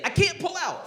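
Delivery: squeaky high mock dad, lightly done. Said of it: "and he's like".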